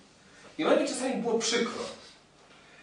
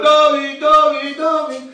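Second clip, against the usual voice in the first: loud, higher shouted name; children shouting; 7 semitones higher, 12 dB louder, slower than usual. Retold or imitated. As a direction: yell the name across the room. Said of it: "A dzieci krzyczą".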